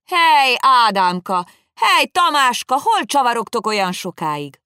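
loud, high call across the road; shouting out. Acted. Old Pipitér shouting a coded call to his captive master. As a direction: holler like calling lost friends. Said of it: "elkiáltottam magam".

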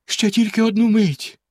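high-pitched entreaty; anxious, high plea; pleading. A narrator's reading. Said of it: "благально мовила дружина".

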